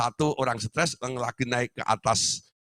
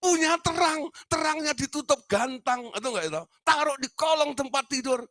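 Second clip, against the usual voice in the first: high, fervent preaching. Acted. The preacher exhorts his congregation, high fervent preaching. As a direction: urge them to show their light.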